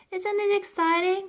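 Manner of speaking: high, loud thrilled question; bubbling over with excitement; excited girl, pitched well up. A narrator's reading. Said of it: "said Florentine".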